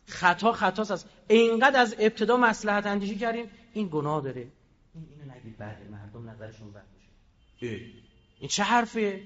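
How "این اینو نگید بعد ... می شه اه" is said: much softer, lower, anxious-sounding mockery; mock hushed caution. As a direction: hush it like a timid official afraid of talk. Mocking overcautious officials.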